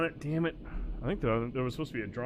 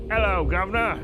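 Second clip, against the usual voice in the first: hammed-up, higher, louder English accent; weak English accent; 7 semitones higher, 6 dB louder than usual. Shown as a demonstration. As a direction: do a bad, cheery-greeting English accent. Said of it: "a real weak-ass English accent".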